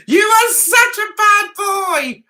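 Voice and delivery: high, slow playful telling-off; drawn-out, high-pitched mock reproach; mock scolding. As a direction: scold a naughty boy in jest. Playfully scolding Dre in the chat.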